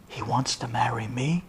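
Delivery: soft, low question rising at the end; small stunned voice; hushed disbelief. Acted. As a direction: ask it quietly, hardly believing it. Bride cannot believe her ears.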